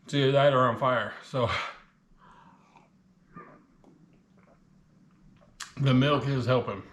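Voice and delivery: talking while still chewing; words around a mouthful; mouth full. Real, not acted. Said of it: "talking with my mouth full".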